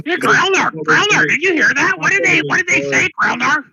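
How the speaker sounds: high, loud, eager little voice; high excited character voice. Acted. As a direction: call out excitedly to Growler.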